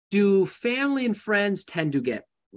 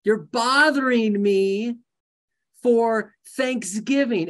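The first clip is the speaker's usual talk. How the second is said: slow complaint; 4 semitones higher, slower than usual. Acted.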